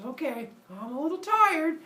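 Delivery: high sleepy nymph; high and drowsy. Acted. Maia sleepy.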